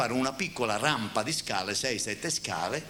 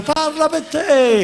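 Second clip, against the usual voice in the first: mock retort; 9 dB louder, much slower than usual. Acted quote, high and slow.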